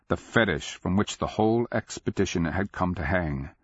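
ordinary delivery, nothing marked

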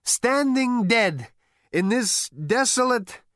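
loud, high, insistent voice; dogged. Acted. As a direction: push on stubbornly. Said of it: "continued Zaphod doggedly".

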